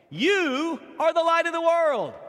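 high, loud, ringing scripture proclamation; proclaimed. Acted. Pastor reading the verse to his church, ringing out. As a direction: declare it over the whole congregation.

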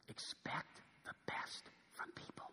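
slow breathy whisper. Real, not acted. Preacher whispering principle three to the congregation. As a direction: drop to a hush to land the point.

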